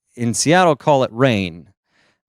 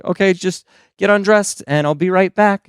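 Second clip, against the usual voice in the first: breezy mock-casual instruction, higher pitched; 7 semitones higher than usual. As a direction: toss it off like a routine request, imitating a therapist. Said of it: "they say it so casually".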